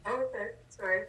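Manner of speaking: muted, distant voice on the call. Real, not acted. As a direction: sounds muffled and far away. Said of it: "You sound a little muted".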